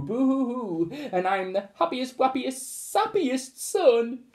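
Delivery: high hammed-up boo-hoo sob; mock happy crying. A narrator's reading. Son bursts into happy tears with his mother, high sobbing. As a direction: blubber it like a sappy son. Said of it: "make the boy burst into tears too".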